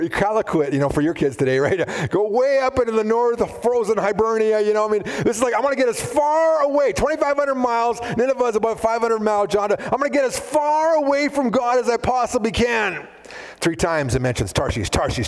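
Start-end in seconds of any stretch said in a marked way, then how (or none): 5.5-13.1 Jonah's defiant vow. Acted. Jonah voiced fleeing God.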